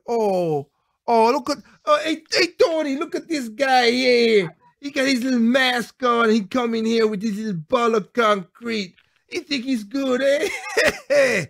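high, slow hammed-up mafia wiseguy; Italian mafia impression. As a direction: play a cartoon Staten Island mobster sizing up a punk. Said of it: "Italian, speaking of mafia".

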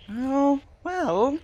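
very high, loud rising exclamation; surprised. Real, not acted. Gamer seeing this could be a time, surprised. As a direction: pipe up high in sudden surprise.

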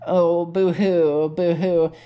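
a half-hearted slow boo-hoo; mock crying. A bug mourns his fallen friend, mock crying. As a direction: fake sob. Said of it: "Oh, I'm crying now".

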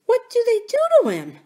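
high, quick frightened question; a scared little princess asking; trembling voice. A narrator's reading. Frightened princess asking what goblins do, trembling. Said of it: "with a trembling voice".

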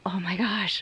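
breathless awed whisper. Real, not acted. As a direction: whisper it in wonder. Awed exclamation about the highway.

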